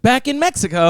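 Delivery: a playful mock of the guest's wistful line. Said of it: "She's like".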